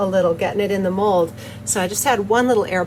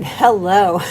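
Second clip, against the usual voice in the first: laughing; 4 dB louder than usual. Real, not acted. Giggling at the bright colors.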